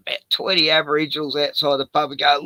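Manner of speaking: ordinary delivery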